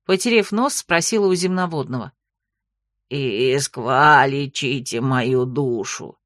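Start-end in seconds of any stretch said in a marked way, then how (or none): 3.1-6.2 low, slow croaking toad; gurgling frog voice. A narrator's reading. Frog character voice.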